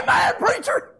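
high, loud, full church holler; hollered amen. Retold or imitated. Young man finally hollering amen to the preacher, loud. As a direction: let it ring out to the pulpit. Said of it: "I said".

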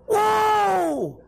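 high, long holler fading down; imitated far-carrying whoop; hollered whoa. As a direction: holler it out across the water for an echo. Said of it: "Hollering out across that water".

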